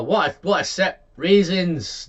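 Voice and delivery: ordinary talk, nothing marked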